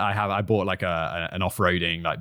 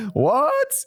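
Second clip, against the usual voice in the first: sharp gasp then much higher "What?"; shocked gasp; 13 semitones higher than usual. Real, not acted. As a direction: gasp and squeak in disbelief. Shocked by neighbour's claim.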